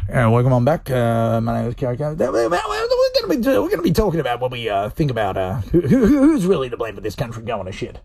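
a hint of a low AM radio host; lightly voiced old-school radio announcer. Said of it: "It's just like".

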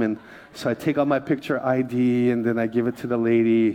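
usual speaking voice

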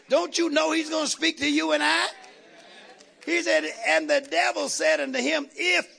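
preacher hitting his peak; preaching climax. Real, not acted. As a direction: lift it up and shout it.

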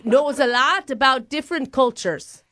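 mock-Irish job applicant; put-on Irish accent. Applicant citing her referral in a put-on Irish accent. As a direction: plead your case in fake Irish. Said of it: "my grandpappy from Ireland coming through".

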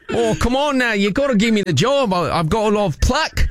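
broad put-on Aussie accent; Australian accent. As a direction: greet them in a hammed-up Australian accent. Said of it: "with a slight Australian accent".

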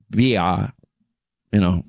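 ordinary delivery

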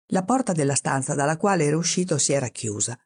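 usual speaking voice

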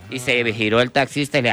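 ordinary talk, nothing marked

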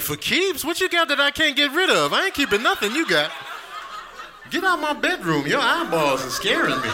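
high, alarmed refusal; alarmed partner. Pretend. Partner in the bedroom, in a high alarmed voice. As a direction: back away in mock horror. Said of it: "Be like".

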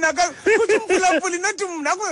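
high, eager objection; excited protest. Real, not acted. To his co-host, shifting the blame to the listener, excited protest. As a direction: push back fast and eager, with a light laugh.

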